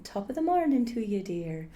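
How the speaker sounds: quick, lilting Irish "Top of the morning"; Irish brogue, deliberately corny; lame Irish accent. Acted. Actor demonstrating a cheesy Irish greeting, in an Irish accent. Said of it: "Irish, it really is that lame".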